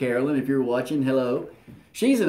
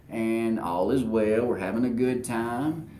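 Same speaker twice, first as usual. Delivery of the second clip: usual speaking voice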